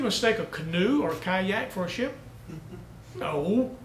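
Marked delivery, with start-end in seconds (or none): none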